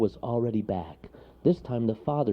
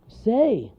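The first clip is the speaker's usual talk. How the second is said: high, loud call to the bear; shouting; 7 dB louder than usual. Acted. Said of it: "shouted Wharton".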